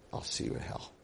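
breathy whispered threat in his ear. Retold or imitated. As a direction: hiss it close and soft. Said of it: "I whisper in his ear".

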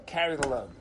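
low, soft, dutiful aside; resigned refrain. Acted. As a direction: drop down low and put up with it. Resigned refrain after each named burden.

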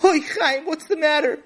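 high, loud, worried mother; fretting mother. Acted. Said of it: "Chaim's mother and father came running in".

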